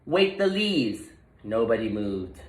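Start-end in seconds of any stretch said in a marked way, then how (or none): 0.0-1.1 higher, slow, confident call; a small rodent finding his voice; stronger voice. Acted. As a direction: call it out with new courage. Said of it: "Wadney's voice was stronger".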